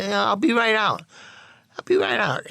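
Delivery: evasive answer from bathroom. Retold or imitated.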